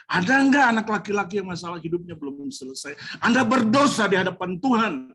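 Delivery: preacher warning the congregation about their families, full force; fiery preaching. Acted. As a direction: drive the warning home to the congregation.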